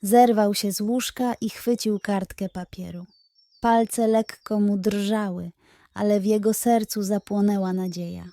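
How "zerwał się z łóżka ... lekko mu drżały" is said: ordinary delivery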